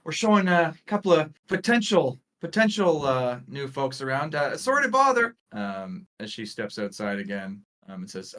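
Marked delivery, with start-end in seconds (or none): 0.0-5.3 high, apologetic guide voice; Juliet. Acted. Guide apologizing to the class for interrupting, apologetic. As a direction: excuse the intrusion, pitched well up. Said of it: "Juliet says".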